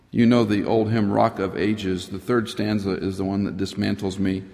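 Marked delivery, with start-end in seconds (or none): none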